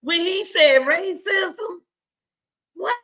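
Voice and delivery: high, slow, breaking into laughing sobs; can barely get the words out for laughing; real helpless laughter, voice cracking high; laughing hard. Real, not acted. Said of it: "I get excited".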